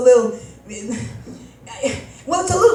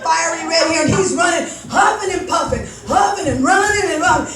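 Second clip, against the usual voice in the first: normal talking voice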